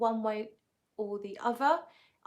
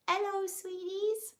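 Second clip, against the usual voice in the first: put-on much higher and slow, a failed try at her accent; Hillary impression attempt; 10 semitones higher than usual. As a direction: pitch it way up and slow it down, imitating her. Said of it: "but she probably goes".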